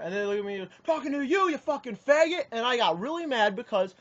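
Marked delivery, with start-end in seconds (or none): 0.9-2.5 high, mildly raised mock yell; retold angry yell. Heckler answering the confused player, imitated angry yell. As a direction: imitate an aggressor snapping back. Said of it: "why are you yelling".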